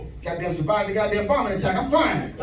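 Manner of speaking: ordinary talk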